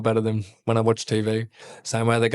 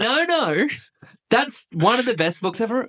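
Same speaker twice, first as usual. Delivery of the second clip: high-pitched, insistent book fan; mock insistence; 10 semitones higher than usual. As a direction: protest in a high put-on voice.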